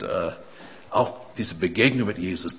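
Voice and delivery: ordinary delivery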